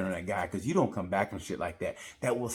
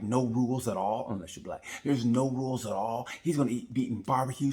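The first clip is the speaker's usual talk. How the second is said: ordinary talk, nothing marked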